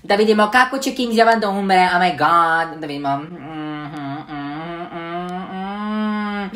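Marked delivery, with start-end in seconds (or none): none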